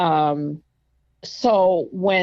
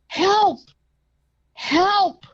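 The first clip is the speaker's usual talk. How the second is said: high, loud shout for help; loudest voice; 13 semitones higher, 4 dB louder than usual. Retold or imitated. Patient shouting for help in the empty ward. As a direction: yell it out as loud as you can. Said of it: "biggest, deepest, loudest voice".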